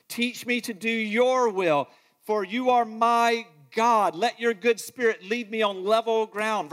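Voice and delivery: fervent psalm reading; scripture reading. Acted. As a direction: read it as a heartfelt plea to God. Reads Psalm passionately.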